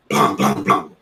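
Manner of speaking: three loud, low, spaced gunshot bangs; a few shots heard from the stoop, low and spaced. Retold or imitated. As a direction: pop them out one by one, steady. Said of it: "And you hear".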